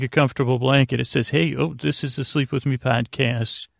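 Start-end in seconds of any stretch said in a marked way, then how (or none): none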